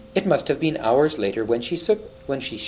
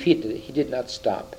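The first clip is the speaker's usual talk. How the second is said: ordinary delivery, nothing marked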